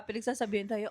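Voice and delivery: ordinary talk, nothing marked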